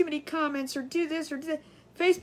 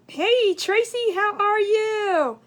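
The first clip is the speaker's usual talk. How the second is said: drawn-out slow greeting, higher and louder; sing-song greeting; 7 semitones higher, 6 dB louder, much slower than usual. Real, not acted. Streamer greeting a viewer by name, slow and sing-song. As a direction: stretch the hello out to the viewer.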